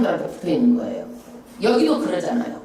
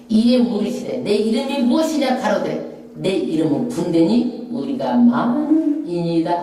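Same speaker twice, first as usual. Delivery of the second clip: scripture read aloud; 4 semitones lower than usual. Acted. Congregational scripture reading.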